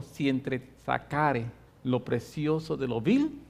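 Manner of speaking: low, soft, slow voice of God. Retold or imitated. God speaking to the pastor while driving, low solemn voice. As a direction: let it rumble low and slow like a voice inside. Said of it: "esa voz de Dios que retumbó".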